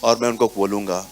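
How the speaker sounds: ordinary talk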